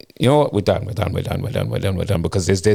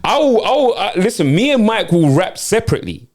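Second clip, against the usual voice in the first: angry; 9 semitones higher than usual. Acted. Boyfriend raising pitch in anger.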